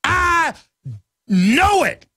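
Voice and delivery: I pitched sharply up and stretched, then know it; emphatic. Retold or imitated. Insisting to magazine writers he knows it. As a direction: hit the I hard and high. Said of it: "And I'm like".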